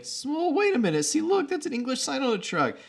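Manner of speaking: very high mock Travolta; squeaky reassurance. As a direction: squeak out the sign point. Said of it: "John Travolta's like".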